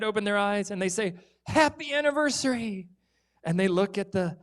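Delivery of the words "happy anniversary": high, bright shout of surprise; spring the surprise on the parents; excited surprise. Acted. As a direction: kids cheering for mom and dad.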